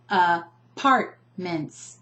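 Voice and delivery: "apartments" slowly in chunks, a-PART-ments; "apartments" split by syllable, middle one strongest; stress on second syllable. Shown as a demonstration. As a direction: tap out "apartments", leaning on PART. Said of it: "I'm emphasizing the second syllable".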